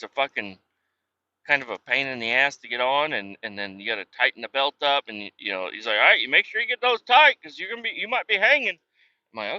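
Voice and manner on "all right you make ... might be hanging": higher, louder mock instructor warning. Imitating the instructor warning about harness straps, higher and louder. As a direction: warn them to tighten up in a higher, bossy voice.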